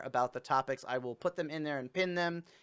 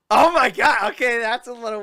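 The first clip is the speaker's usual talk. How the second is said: surprised; 8 semitones higher, 13 dB louder than usual. Real, not acted. Shock at the picked topic.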